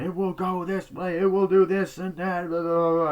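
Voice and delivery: high, chanted declaiming; mock proclamation. Retelling the man in the film, mock proclamation.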